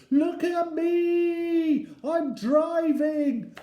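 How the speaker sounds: very high, drawn-out boastful shout. A narrator's reading. Eddie at the steering wheel to his family, high shouting. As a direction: shout it like a little child, stretching words. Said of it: "grabbed hold of the steering wheel and shouted".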